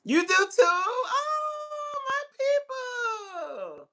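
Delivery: very high, stretched squeal of joy; thrilled, high-pitched and drawn out; delighted squeal. Real, not acted. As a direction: squeal at finding your own people. Delighted a viewer shares it.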